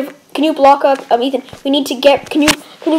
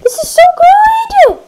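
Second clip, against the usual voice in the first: excited; 14 semitones higher than usual. Real, not acted. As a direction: gush with delight. Thrilled at the find.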